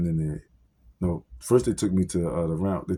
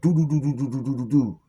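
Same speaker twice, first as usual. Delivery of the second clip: do-do-do beats, loud and high, mimicking fists on a door; mock police banging on the door; imitating loud door pounding; 7 semitones higher than usual. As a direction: pound out the knocking with your voice. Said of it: "we get a bang on the side door".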